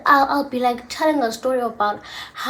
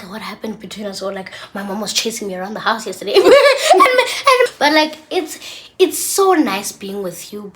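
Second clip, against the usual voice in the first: ordinary talk, nothing marked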